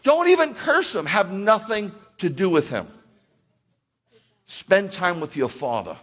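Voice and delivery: rising to a full-throated sermon climax; fiery preaching. Real, not acted. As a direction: preach it full force to the church.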